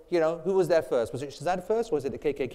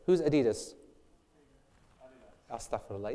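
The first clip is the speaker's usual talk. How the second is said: normal talking voice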